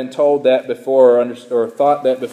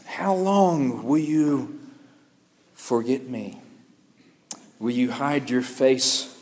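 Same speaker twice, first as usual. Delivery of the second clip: lamenting voice; 5 dB quieter than usual. Retold or imitated. Pastor voicing the psalmist's lament, softer and slowed. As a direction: let each question sink in quiet grief. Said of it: "the lamenting voice of the psalmist".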